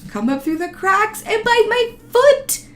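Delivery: high, loud panicked rise. Real, not acted. Fearing the spider under the porch, loud high panic. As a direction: spiral into panic over the spider. Imagining spider biting foot.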